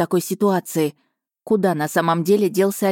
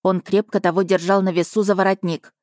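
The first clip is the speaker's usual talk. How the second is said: ordinary delivery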